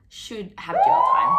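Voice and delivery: sudden high, loud burst of outrage; outraged shout. Real, not acted. Telling viewers abusers deserve jail, shouting it out. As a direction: blast it out like a verdict.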